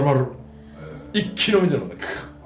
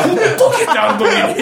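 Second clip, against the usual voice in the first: 1 laughing mock yell; 13 semitones higher, 8 dB louder than usual. Retelling his own scold.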